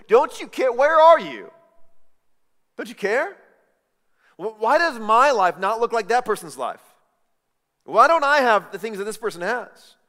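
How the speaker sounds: building from plain to loud, high complaint; escalating plea to God, getting heated; frustrated prayer. Retold or imitated. Believer complains to God, building to loud frustration. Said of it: "prayed the prayer or had the thought".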